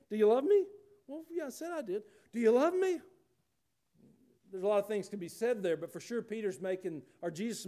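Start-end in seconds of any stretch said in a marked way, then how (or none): 0.1-0.7 louder, higher, pointed repeat question; voiced Jesus pressing for an answer. Acts out Jesus asking Peter again. 1.1-2.0 much higher, softer put-on reply; mock-defensive answer, small and high; sheepish Peter. Acts out Peter answering the repeated question. 2.3-3.0 much higher, loud, pressing; voiced Jesus asking yet more insistently; insistent repeated question. Acts out the question asked once more.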